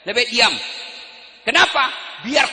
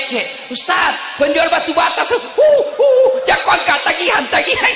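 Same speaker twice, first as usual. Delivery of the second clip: mimicked wife's high yelling; teriakan istri; 7 semitones higher, 5 dB louder than usual. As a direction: call out loudly, pitch leaping up in comic alarm. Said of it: "berteriak mamanya". (menirukan istri berteriak)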